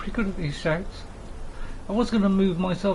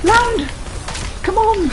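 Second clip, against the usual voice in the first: shout; 11 semitones higher, slower than usual. Acted. Shouting at dragon.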